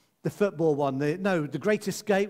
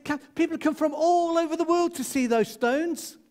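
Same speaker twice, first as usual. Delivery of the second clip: higher, louder pleading in disbelief; exasperated pleading; 11 semitones higher, 4 dB louder than usual. Retold or imitated. Dad pleading with his teenage daughter about Stonehenge, higher exasperated plea. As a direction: plead as if she must see it.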